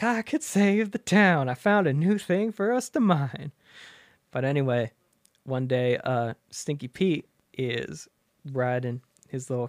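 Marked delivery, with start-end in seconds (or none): 0.0-3.5 a hint of the goofy miner, eager; prospector voice. Stinky Pete tells the town about oil, light old-timer voice. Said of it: "Stinky Pete's like".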